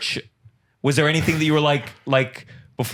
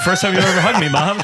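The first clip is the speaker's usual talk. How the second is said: louder, laughing mock question; 6 semitones higher, 5 dB louder than usual. Friend joking as the son to his mother, laughing. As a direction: ask it through laughter. Friend voicing son's joke.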